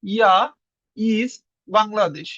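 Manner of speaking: very slow ইজ Bangladesh; slow demo. Shown as a demonstration. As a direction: drag it out for learners. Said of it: "এটার উত্তর হবে কি". (খুব ধীরে উচ্চারণ)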